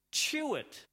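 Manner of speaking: sudden high punch on "Chew it"; emphatic chew. Shown as a demonstration. Preacher urging congregation to chew the word, high and punchy. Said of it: "Chew that word".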